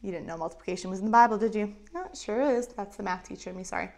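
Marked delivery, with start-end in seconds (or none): none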